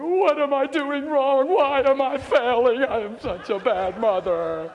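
loud, slow, high wail; mock despairing mother. Mother failing with her toddler, hammed-up despairing wail. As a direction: ham up a mother's self-blame.

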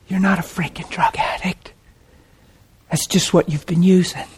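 hushed, near-whispered comfort; gentle whisper, with long pauses; tender reassurance. Retold or imitated. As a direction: speak very softly to someone weeping. Retells tenderly consoling the crying man.

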